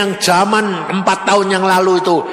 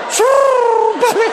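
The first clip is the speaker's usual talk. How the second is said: calling out; 3 dB louder, much slower than usual. Retold or imitated. Calling out on mountain.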